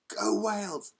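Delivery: loud whispered cheer. Real, not acted. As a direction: cheer under your breath. Cheering football result.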